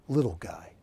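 a conspiratorial whispered jab; whispered aside. Real, not acted. To Wilson about the artist's height, whispered. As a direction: drop to a whisper for the punchline.

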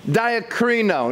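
"Diakrino" said slowly, DIE-ah-KREE-no; Greek word repeated. Shown as a demonstration. Pastor teaching the congregation a Greek word, slow. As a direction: spell out "Diakrino" slowly for the notes. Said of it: "is the word diakrino".

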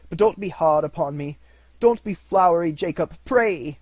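high, loud, urgent plea; anxious pleading. A narrator's reading. Dismayed Scrooge begs the ghost for mercy, pleading.

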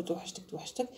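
soft whispered توحشتك; whispered mock i miss you. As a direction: breathe توحشتك soft and clingy. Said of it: "تقعدي تعيطي تقولي له".

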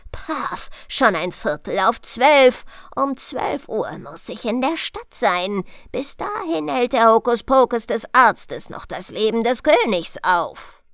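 ordinary delivery, nothing marked

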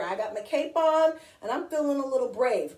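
ordinary delivery, nothing marked

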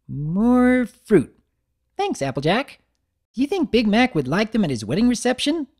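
high, eager, bouncy pony voice; bubbly Pinkie voice. Acted. Pinkie inviting Big Mac upstairs, high and bubbly. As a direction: chirp it up high like an excited party pony.

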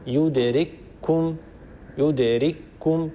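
يدرككم said slowly with one doubled kaf. Shown as a demonstration. Teacher repeating يدرككم slowly for students. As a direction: stretch يدرككم, merging the kafs. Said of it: "كاف مشددة".

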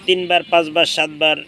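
ordinary talk, nothing marked

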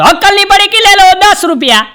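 high, loud street cry; hawker's call. Retold or imitated. Hawker shouting high and loud to passers-by. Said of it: "રાડો પાડતો હતો".

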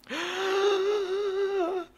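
overjoyed gasp. Real, not acted. Finally gets the golden knife.

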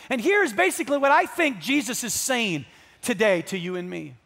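loud, high-pitched pulpit delivery; emphatic, ringing proclamation to the congregation; impassioned preaching. Real, not acted. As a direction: lift it into full preaching voice.

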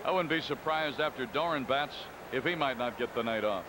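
ordinary talk

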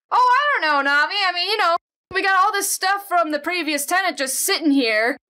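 mock excited. Teasing the character Nami.

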